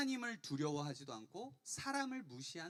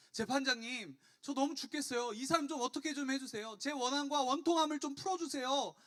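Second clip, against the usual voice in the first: high, loud desperate plea; pleading widow; 8 semitones higher, 6 dB louder than usual. Acted. Widow begging the unjust judge to avenge her, pleading loudly. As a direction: beg the judge, voice pitched up and pushed hard. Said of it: "이 과부가 이 재판장을 찾아와서 이렇게 얘기해요".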